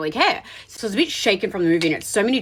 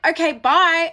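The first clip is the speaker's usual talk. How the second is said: high, loud dismissive goodbye; pointed send-off; 7 semitones higher, 5 dB louder, slower than usual. Retold or imitated. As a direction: act out shooing him away, pitched up. Acts out her hurried send-off.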